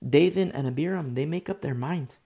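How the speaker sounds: usual speaking voice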